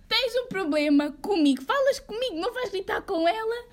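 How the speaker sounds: high, heated re-enacted challenge; retold angry questioning, pitch climbing; confrontational challenge. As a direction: square up to him indignantly.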